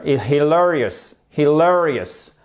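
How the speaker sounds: "hilarious" stressed on LA, hi-LA-rious; "hilarious" with a light first syllable and weighty LA; stress on second syllable. Shown as a demonstration. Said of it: "mettre notre accent tonique ici".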